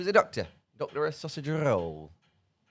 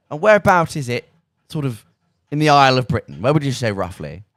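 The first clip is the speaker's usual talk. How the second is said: mock interrogation; 13 dB louder than usual. Pointed mock quiz about geography.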